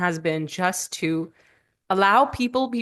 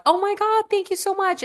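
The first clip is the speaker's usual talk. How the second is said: much higher, gushing thanks; overjoyed cry of thanks; grateful excitement; 13 semitones higher than usual. Retold or imitated. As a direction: pitch jumps up in delight at the pizza. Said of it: "you open the door and you're like".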